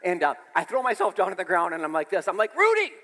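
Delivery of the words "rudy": high shouted call of Rudy; calling the dog. Retold or imitated. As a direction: yell for the runaway dog. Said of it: "I said".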